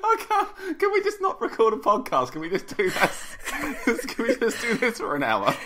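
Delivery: talking through real laughter, pitch up high; can barely get the words out for giggling; laughing. Real, not acted. Cracking up at Zoolander request.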